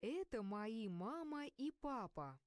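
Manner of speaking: usual speaking voice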